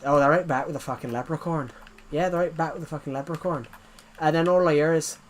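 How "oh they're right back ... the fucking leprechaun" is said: mock Irish accent thrown back at a caller; hammed-up Irish comeback. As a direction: answer in a put-on Irish accent. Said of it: "I did the Irish accent back".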